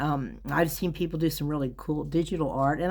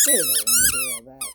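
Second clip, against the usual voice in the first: very high put-on voice for the dog; high squeaky dog voice; faster than usual. Voicing Daisy answering the owner, very high squeaky.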